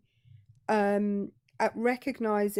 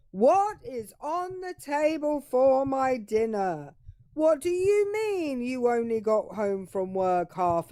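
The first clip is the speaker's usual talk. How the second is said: demanding husband; 4 semitones higher than usual. Acted. Caller demanding his dinner from his wife, mock-pompous. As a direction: demand it in a put-on voice. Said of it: "He's saying".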